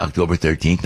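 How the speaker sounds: ordinary delivery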